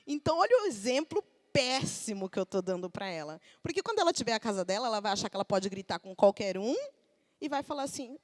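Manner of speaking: ordinary talk, nothing marked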